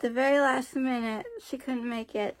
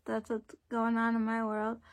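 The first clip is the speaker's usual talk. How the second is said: normal talking voice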